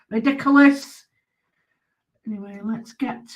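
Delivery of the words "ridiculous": louder exasperated repeat; exasperated outburst. Real, not acted. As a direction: throw up your hands, louder. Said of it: "Why did they do it".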